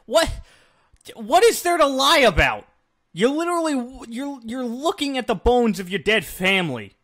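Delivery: incredulous outrage. Real, not acted. Outraged at scene's logic.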